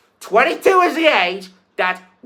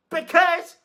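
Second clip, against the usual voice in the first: ordinary talk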